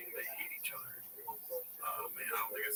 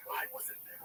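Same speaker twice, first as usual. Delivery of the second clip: ordinary delivery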